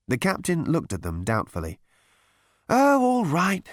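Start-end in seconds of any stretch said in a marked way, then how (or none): 2.7-3.6 high-pitched, doubtful giving-in; reluctant consent. A narrator's reading. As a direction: give in grudgingly, up high. Captain reluctantly gives in.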